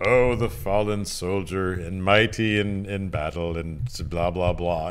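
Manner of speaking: mock solemn eulogy. A podcaster mocking the villain's tribute, low droning mock eulogy. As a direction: drone it out like a hollow eulogy.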